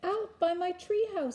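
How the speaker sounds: put-on high, urgent child's report; excited storybook kid; excited boy. A narrator's reading. Boy reporting to startled father.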